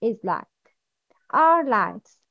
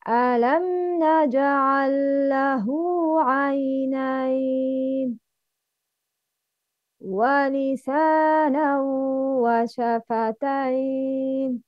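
Quran recitation. Acted. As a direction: recite with measured tajweed. Recites Surah Al-Balad.

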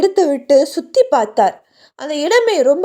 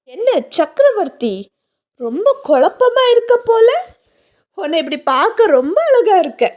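high, eerie voice mocking his confusion; taunting ghost; 6 semitones higher than usual. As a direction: tease him from beyond, voice lifted and strange. (கேலி செய்யும் பேய்க் குரல்)

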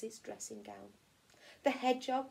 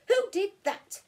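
higher, louder cross squirrel; indignant squirrel asks who pushed him; 9 semitones higher, 10 dB louder than usual. Acted. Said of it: "he said crossly".

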